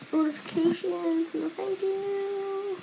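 high, slow brush-off in a put-on voice; mock dismissive. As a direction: wave off the pop-ups in a silly voice. Brushing off popping notifications.

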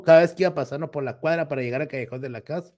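ordinary delivery

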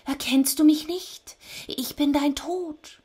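high, thin, amazed creature voice; astonished Death. A narrator's reading. Death is amazed not to be recognised, astonished. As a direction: announce yourself high-voiced, in disbelief. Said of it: "Da staunte Thanatos und sagte".

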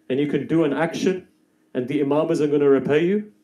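a preacher rousing the crowd at the peak; fiery preaching. Acted. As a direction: preach it to the whole hall with full force. Preaching builds to full force.

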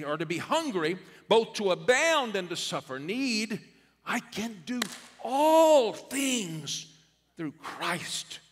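high, loud, ringing pulpit cry; shouted sermon refrain; preaching at full force. Acted. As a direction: preach it out to the back row. Preacher proclaiming scripture.

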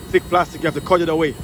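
normal talking voice

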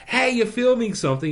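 high-pitched imitation of a nosy onlooker; mock intrusive bystander. Onlooker walking up to a film crew, mock oblivious curiosity.

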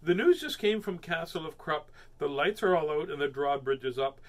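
newsy storybook voice; story reading. A narrator's reading. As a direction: announce it like a kids' story. Reading aloud to children.

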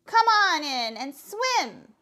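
loud, slow holler of invitation; calling out. Acted. Mother calls Froggy into the pond, loud slow call. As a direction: call out slowly to someone across the water.

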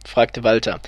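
ordinary delivery

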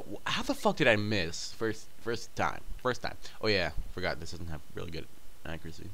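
ordinary talk, nothing marked

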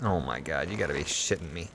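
low, soft disbelieving grumble; low, quiet disbelief; exasperated mutter. Real, not acted. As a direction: mutter it under your breath. Exasperated at chat's advice.

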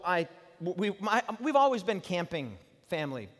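ordinary talk

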